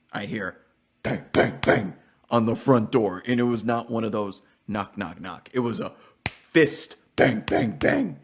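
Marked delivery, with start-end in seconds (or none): 1.0-3.2 loud punchy bangs; imitated banging. Streamer mimicking neighbor pounding the door, loud. 6.3-8.2 loud, high, slow pounding bangs; loud mock banging. Streamer acting out neighbor's fist on door, loud.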